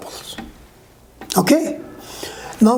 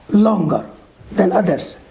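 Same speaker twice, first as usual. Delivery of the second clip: normal talking voice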